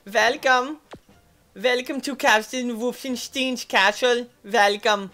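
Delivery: high, hammed-up German accent; mock German accent. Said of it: "I talk like this".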